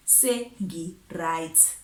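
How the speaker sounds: SENGI RIGHT drawn out very slowly; slow, emphatic repeat. Retold or imitated. Telling the ex she is done, "Sengi right" slow and emphatic. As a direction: stretch out "Sengi right", word by word.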